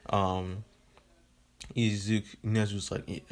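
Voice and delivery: normal talking voice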